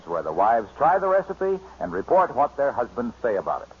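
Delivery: ordinary talk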